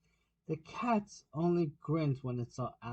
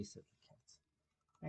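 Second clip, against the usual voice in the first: slow breathy whisper of the tag; 17 dB quieter, much slower than usual. A narrator's reading. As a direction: let the words fade to a slow whisper.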